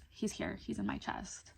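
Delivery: soft, almost whispered reply; quiet reassurance. Retold or imitated. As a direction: answer the worried mom softly, fading out. Drugged self answers alarmed mom.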